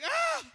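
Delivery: short, high-pitched loud cry; acted yell. Young preacher reacting to his mentor's charge, yelling. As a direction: burst out with a startled-sounding yell.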